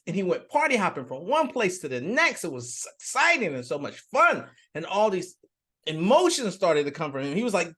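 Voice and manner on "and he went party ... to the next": ordinary talk, nothing marked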